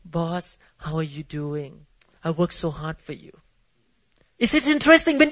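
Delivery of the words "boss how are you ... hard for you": much softer, lower, ingratiating; butter up the boss quietly; sweet-talking the boss. Retold or imitated. Worker sweet-talking the boss for a raise, much softer. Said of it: "you go to your boss".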